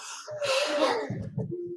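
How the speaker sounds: long audible exhale; calming breath. Shown as a demonstration. Coach models breathing before answering a teasing sibling, long sigh. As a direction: breathe out slowly to keep your temper.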